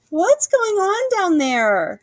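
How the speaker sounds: high, slow drowsy call; sleepy squirrel shouting. A narrator's reading. Woken squirrel calling from the treetop, slow high shout. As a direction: yell down half asleep. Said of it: "shouted a sleepy-eyed squirrel".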